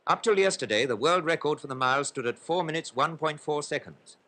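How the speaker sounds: usual speaking voice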